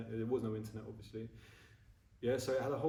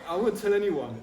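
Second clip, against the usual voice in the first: laughing; 7 semitones higher, 11 dB louder than usual. Real, not acted. Laughing while retelling secrecy.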